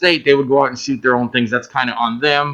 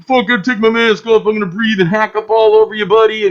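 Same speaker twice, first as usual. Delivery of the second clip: a raised, defiant jerk who won't wear a mask; hammed-up couldn't-care-less loudmouth; mock careless jerk; 7 semitones higher, 4 dB louder than usual. Careless crew member brushing off the mask rule, hammed-up and loud. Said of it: "I didn't wanna be the one that was like".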